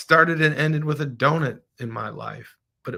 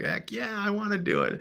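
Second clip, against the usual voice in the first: much higher, eager mock reply; a playful high-pitched heck yeah; eager yes; 10 semitones higher, 5 dB quieter than usual. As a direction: jump at the chance, pitched way up. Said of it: "I'm like".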